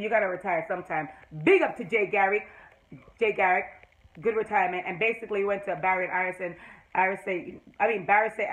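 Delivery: normal talking voice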